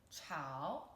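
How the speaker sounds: 炒 said with a low dipping chǎo that rises at the end; teacher showing viewers the third tone on 炒, slow demo. Shown as a demonstration. Dip low and come back up on 炒. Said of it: "third tone".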